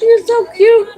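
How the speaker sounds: put-on baby talk. Cooing to the kitten tucked in her shirt, high baby talk. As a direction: coo it to a tiny kitten.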